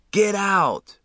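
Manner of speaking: high, loud yell over the fire; Johnny yelling at his friend to escape the burning church; urgent shout. Acted. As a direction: shout him out the window. Johnny shoves him toward window.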